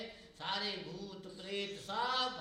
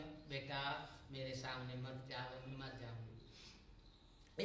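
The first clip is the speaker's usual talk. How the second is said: mother pleading; 5 semitones lower, 8 dB quieter, slower than usual. Retold or imitated. Mother pleads with her son.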